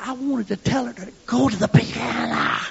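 lightly voiced little boy; boy explaining to the church lady; child's answer. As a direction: answer like a tired young boy.

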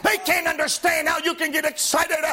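rising, shouted gospel preaching; preaching at full force. Acted. Preacher to his congregation, shouting, fervent. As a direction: lift the congregation with fiery, shouting delivery.

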